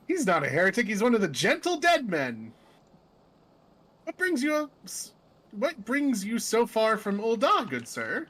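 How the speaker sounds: lively, polite character voice; friendly adventurer. Acted. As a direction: defend him, then inquire courteously. Character greets the gentleman zombie.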